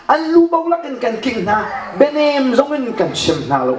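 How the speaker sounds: normal talking voice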